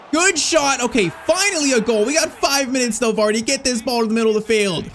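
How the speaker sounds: celebrate, then rally the team urgently; esultanza concitata. Real, not acted. Late goal, urging his team on.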